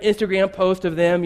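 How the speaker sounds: usual speaking voice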